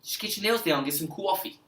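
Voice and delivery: put-on playful New York accent, drawn out at the end; mock New York accent. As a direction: ham up a New Yorker's accent. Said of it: "try to do a New York accent".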